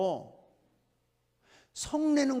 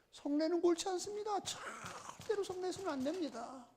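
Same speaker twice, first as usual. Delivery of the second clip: high, soft, strained warning; hushed admonition; 7 semitones higher, 10 dB quieter than usual. Real, not acted. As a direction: plead softly but intensely.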